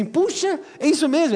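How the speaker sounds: a big pitch jump of disbelief; surprised. Retold or imitated. Não crente surpreso. (surpresa exagerada, voz bem aguda e alta)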